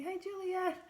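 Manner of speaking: rushing back from the store, out of breath; breathless greeting. Retold or imitated. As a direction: gasp out a cheery hi. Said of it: "still out of breath".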